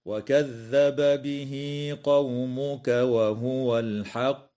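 slow melodic tajweed chant, stopping on الحق with a bounce on the qaf; drawn-out Quranic cantillation; Quran recitation. Shown as a demonstration. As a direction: recite it slowly in chanted tartil. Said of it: "هكذا".

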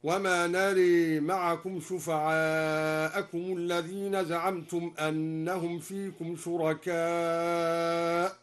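"نرى" with the alif tilted toward e, slow chant; imala on nara. Shown as a demonstration. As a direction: say "نرى" as nare. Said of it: "وأمال نرى". ("نرى" بالإمالة، ترتيل بطيء)

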